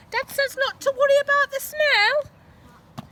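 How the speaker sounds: put-on high, loud, slow girl's message. A narrator's reading. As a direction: deliver dad's message as the girl. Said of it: "she said".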